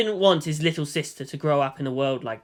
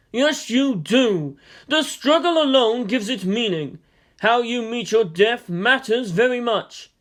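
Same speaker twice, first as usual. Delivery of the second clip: higher, louder otherworldly voice, slowing at the end; ghostly voice; 6 semitones higher, 4 dB louder than usual. Acted. Dead strategist's ghost answering the colonel, high eerie voice. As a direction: speak as a calm ghost rebuking.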